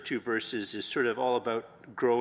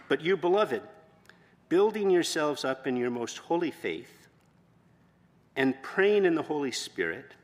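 scripture reading; 2 semitones higher, 4 dB louder than usual. Acted. Pastor reading Jude's verses to the church, solemn. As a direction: read it out with solemn weight.

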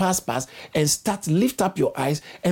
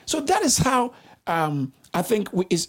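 normal talking voice

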